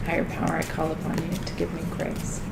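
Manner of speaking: soft, hushed prayer; quiet prayer. Shown as a demonstration. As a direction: pray it softly. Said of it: "So call on it".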